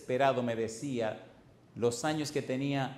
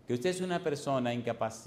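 normal talking voice

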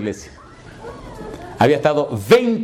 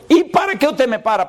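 high-pitched indignant question; retold upset demand, pitched up; tearful, angry; 10 semitones higher than usual. Stopped driver questioning the pastor, mock tearful anger. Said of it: "lloroso y rabioso".

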